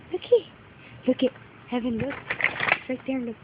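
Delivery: breathy hushed whisper. Real, not acted. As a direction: whisper it so the bird stays. Hushed pointing for the child.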